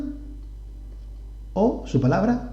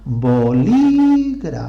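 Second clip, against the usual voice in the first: "bolígrafo" with a long, high, loud LÍ; bo-LÍÍÍ-gra-fo, "bolígrafo" with an exaggerated stressed second syllable; stress on antepenultimate syllable; 4 semitones higher, 5 dB louder, much slower than usual. Shown as a demonstration. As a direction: hold and push the LÍ in "bolígrafo". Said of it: "está en su antepenúltima posición".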